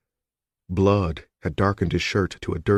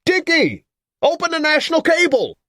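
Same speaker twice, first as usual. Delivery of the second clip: much higher yell from across the room; distant yell; 5 dB louder than usual. A narrator's reading. Newsman yells to his colleague away from the phone. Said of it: "yelled distantly".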